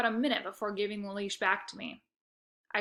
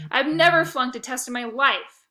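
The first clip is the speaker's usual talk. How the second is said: loud, indignant insistence; angry denial; 4 semitones higher, 9 dB louder than usual. A narrator's reading. Lexi denies flunking to Shelby, loud and indignant. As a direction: defend yourself hotly.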